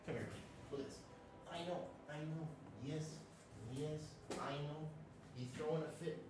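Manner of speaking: talking to a pet. Real, not acted. Cooing to his pet lizard.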